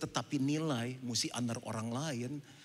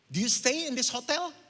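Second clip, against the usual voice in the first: much louder and higher, indignant; angry English rant; 7 semitones higher, 6 dB louder than usual. Retold or imitated. Pastor to rude waiter, heated scolding. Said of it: "Gue kalau marah Inggrisnya lancar".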